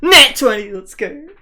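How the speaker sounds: loud, high triumphant cheer; excited. Real, not acted. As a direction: cheer the lucky roll. Celebrating the roll.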